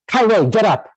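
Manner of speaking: pushy request to a seatmate; rude demand. Shown as a demonstration. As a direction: bark it as a rude command. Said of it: "that's very rude".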